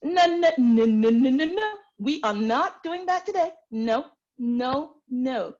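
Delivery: high, sassy Southern refusal; playful Southern scolding; Southern accent. Shown as a demonstration. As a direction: rattle off loud high Southern objections, then ease off. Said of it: "I can do my southern accent".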